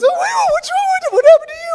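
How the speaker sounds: high, loud alarmed cry; panicked wife. Retold or imitated. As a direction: shriek it in alarm at the blood. Wife panics seeing his blood.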